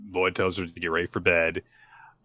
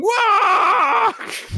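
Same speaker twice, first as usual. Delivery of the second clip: very high, loud drawn-out "What?"; shocked shriek; 6 dB louder than usual. Retold or imitated. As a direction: shriek it while flapping her arms. Anya running around, shocked.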